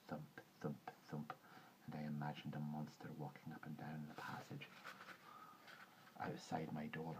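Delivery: low, soft, secretive storytelling; hushed suspense narration. A narrator's reading. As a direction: drop to a near-whisper as the dread creeps in.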